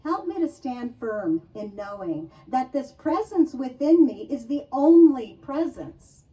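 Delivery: fervent spoken prayer, building then settling; prayer reading. Acted. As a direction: pray it aloud with conviction. Reads the whole prayer aloud.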